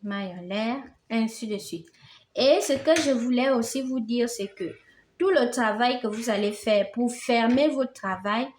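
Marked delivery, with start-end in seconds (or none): none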